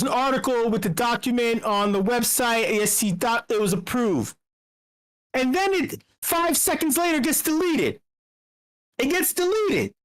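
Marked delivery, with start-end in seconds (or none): 5.3-9.9 telling the shock with rising excitement; excited. Real, not acted. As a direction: voice jumps up high, animated. Excited retelling.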